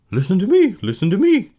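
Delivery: loud, high goose screech; frantic high squawk; screaming goose. Acted. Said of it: "screamed the goose".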